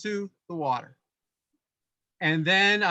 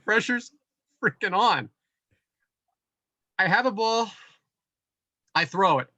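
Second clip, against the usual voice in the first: normal talking voice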